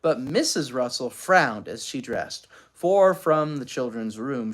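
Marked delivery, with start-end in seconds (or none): none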